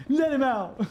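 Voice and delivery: high, loud mock demand; playful shout. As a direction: yell it out playfully. Yelling at the frog in mouth.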